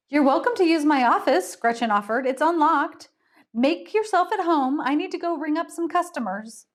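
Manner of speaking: high, quick cheerful host; breezy hostess. Acted.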